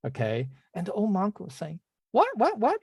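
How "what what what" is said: high-pitched, repeated protesting "what"; mock disbelief. Old monk objecting to the deity, high-pitched mock disbelief. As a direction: squeak out an incredulous objection.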